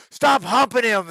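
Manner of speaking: loud, high jeer; heckling fan. Retold or imitated. Fan yelling at the wrestler on the mat, mock shout. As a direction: yell it down from the bleachers.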